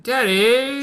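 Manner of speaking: very high, loud mock wail; high whining Daddy; whiny Daddy. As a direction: shriek like a spoiled princess. Daughter wails at father.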